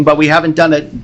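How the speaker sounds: usual speaking voice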